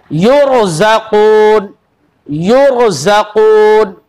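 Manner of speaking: युरज़क़ून slow, ra full and heavy. Shown as a demonstration. Teacher recites a Quran word for children, slowly. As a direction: draw out युरज़क़ून, ra thick. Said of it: "यहां पर भी पुर पढ़ा जा रहा है".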